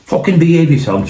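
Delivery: a hothead ordering someone to behave; retold shout. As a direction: snap it at him mid-scuffle. Shouting at the man in a headlock.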